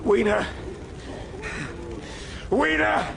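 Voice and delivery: high desperate calls; frantic call for lost Wiener. Acted. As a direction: shout her name into the dark.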